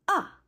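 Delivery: Ah dropping sharply from high; fourth tone. Shown as a demonstration. Teacher showing the falling tone, sharp drop. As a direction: snap Ah down from high, like a pained cry. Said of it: "The fourth tone is a falling tone".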